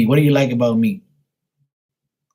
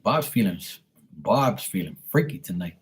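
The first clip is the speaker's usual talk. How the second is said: lower, softer mock-seductive read; sultry low voice; 3 semitones lower, 6 dB quieter than usual. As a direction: purr it like a tease. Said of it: "She normally don't talk like that".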